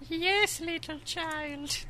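high put-on baby-soothing voice; cartoonish high nanny voice; housekeeper cooing. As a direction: coo it high to an infant. Plays housekeeper on baby monitor.

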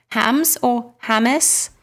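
slow, questioning "Hams", then two-syllable "Hamis"; two pronunciations. Shown as a demonstration. Host unsure of a listener's surname, offering "Hams" or "Hamis" slowly. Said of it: "two different ways on the face of it to pronounce".

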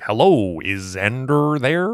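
hammed-up American accent, drawn out and rising; stilted, stretched-out phony American hello; bad fake American accent. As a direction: imitate a Brit badly faking an American accent. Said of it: "pretending to do an American accent badly".

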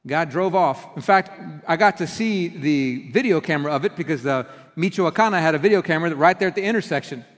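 usual speaking voice

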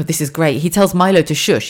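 normal talking voice

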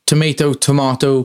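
"Tomato" first with an AY, then "tomato" with an AH; two pronunciations. Shown as a demonstration. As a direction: say "Tomato, tomato" as tom-AY-to, tom-AH-to.

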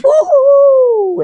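cheering. Real, not acted. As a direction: let out a big happy whoop. Cheering that lemon suckers change.

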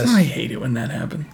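dry, flat retelling of the line; deadpan. Retold or imitated. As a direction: keep it completely dry. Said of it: "deadpan kind of delivery".

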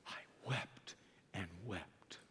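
barely audible grieving whisper. Acted. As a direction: breathe the words out in grief. Whispered weeping quote.

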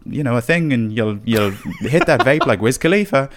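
playful Irish impression edging into laughter; Irish accent. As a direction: improvise as an Irish character, amused. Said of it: "an Irish accent and a Mancunian".

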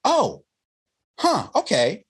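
high, startled oh, huh, okay; a stunned exec's high, hesitant okay; taken-aback oh. Retold or imitated. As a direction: mimic a caught-off-guard executive. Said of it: "And he goes".

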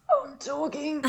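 high, tight and pushed-out, trying to sound fine; strained mid-contraction talking. Shown as a demonstration. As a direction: squeeze "I'm talking" out through a contraction. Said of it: "I was talking like this".

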